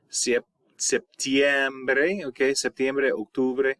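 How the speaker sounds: ordinary delivery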